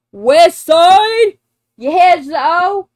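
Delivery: loud, high burst of realization; excited. Real, not acted. Solving the [name] lyric for a friend, excited. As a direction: shout it out as the answer clicks. Solves the lyric riddle aloud.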